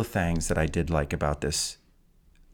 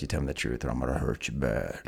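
much lower, softer gruff threat; Rambo impression; 8 semitones lower, 6 dB quieter than usual. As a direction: growl it low like a mumbling action hero. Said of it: "Rambo slams a knife on the table and says".

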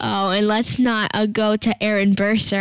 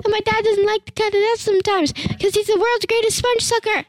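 excited panic; 9 semitones higher than usual. Real, not acted. Kid panicking to viewers about losing the recording, rushed. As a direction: blurt it out all at once.

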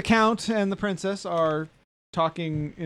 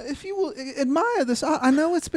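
much higher, snooty noble lady; haughty princess; 10 semitones higher than usual. Acted. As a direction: look down on the commoner.

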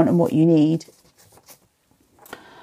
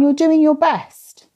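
higher, slow, tender reassurance; speaking gently to a struggling mum, higher and slow; reassuring; 7 semitones higher, slower than usual. Real, not acted. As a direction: soothe Linda like a worried friend. Comforting Linda.

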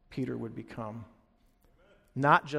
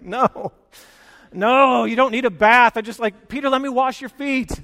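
loud, much higher, mock-exasperated protest; a hammed-up Jesus losing patience with Peter; 7 semitones higher, 11 dB louder than usual. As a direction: wave him off, laughing at his overeagerness.

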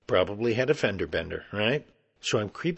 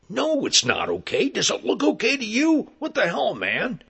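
high, loud, irate outburst; angry yelling; 12 semitones higher, 5 dB louder than usual. Retold or imitated. Hairy man snapping at a would-be helper, angry yelling. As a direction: yell it back in outrage, pitch shooting up. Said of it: "he's yelling at me".

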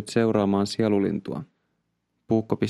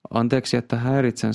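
usual speaking voice

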